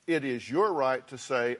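normal talking voice

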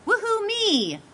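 high, gleeful cheer sliding down; mock celebration. Seller who got over the odds, high whoop of triumph. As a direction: whoop it up in self-congratulation. Acts out delighted house seller.

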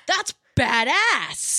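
high, slow, drawn-out approval; impressed exclamation. Retold or imitated. Gamer hearing a gun named after them, impressed exclamation. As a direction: stretch it out high, thrilled by the honor. Retells admiring a gun name.

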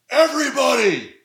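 high, stretched-out strained holler; retold bellow, each word drawn way out; mock top-of-lungs yell. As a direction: imitate a pitcher yelling to the whole field. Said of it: "At the top of his lungs".

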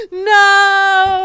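parents' alarmed wail; panicked parents. Retold or imitated. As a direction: cry out as if your child is hurt. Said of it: "they're like".

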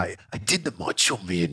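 low gravelly-free Randy Savage growl, lightly done; Macho Man impression. Shown as a demonstration. Dad showing his Macho Man impression. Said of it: "Randy Savage impression".

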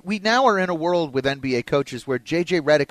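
usual speaking voice

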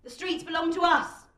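loud, high defiant cry; protester's shout. A narrator's reading. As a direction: shout it out from the crowd. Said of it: "someone shouts".

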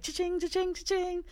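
high, sing-song cha-ching; gleeful cha-ching. Retold or imitated. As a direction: ring it up like a jackpot. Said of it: "And so I was like".

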